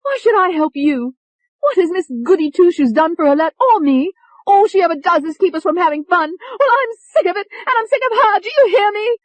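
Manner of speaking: high, loud furious rant building to a shriek; angry screaming. A narrator's reading. Tony refuses to help the doctor, high furious screaming. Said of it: "She was screaming".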